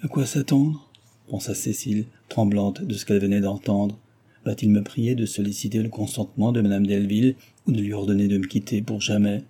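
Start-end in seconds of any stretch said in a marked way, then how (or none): none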